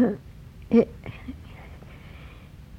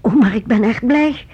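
anxious forced cheer; 5 semitones higher, 6 dB louder than usual. Acted. Frightened mother insists she's glad.

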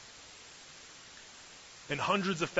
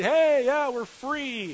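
high, loud whoop of freedom; acted-out shout of joy; jubilant cheer; 12 semitones higher, 9 dB louder, much slower than usual. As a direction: cheer like a crowd just set loose. Acts out freed people celebrating.

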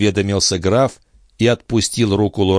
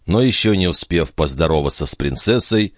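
ordinary delivery, nothing marked

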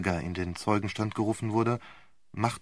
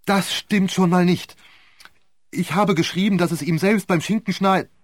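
higher, louder furious interruption, cut off; beside herself with rage; 8 semitones higher, 7 dB louder than usual. Acted. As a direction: lose control and blurt it out. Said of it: "Außer sich vor Wut fiel ihm die Zeugin ins Wort".

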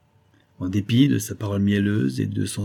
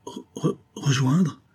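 halting, breathless re-, re- before the word; stunned stammer; 5 semitones higher, slower than usual. Acted. Saphir stunned by the viscount's offer, stammering. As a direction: stammer it out in disbelief. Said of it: "articula lentement Saphir".

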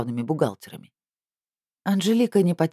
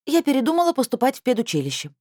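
put-on young girl, higher and defiant; teen girl voice; 9 semitones higher than usual. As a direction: pitch up for a stubborn teenager. Teen girl Anzhelika's lines.

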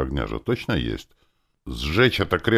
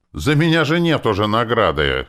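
loud, high objection; raised, urgent exclamation; exclaimed protest; 9 semitones higher, 7 dB louder than usual. A narrator's reading. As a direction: cry out in protest. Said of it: "воскликнул я".

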